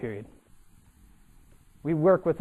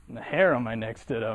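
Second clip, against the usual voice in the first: usual speaking voice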